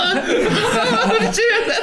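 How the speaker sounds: high whinnying horse laugh; horse nicker. Pretend. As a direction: neigh like a horse. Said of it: "do the horse voice".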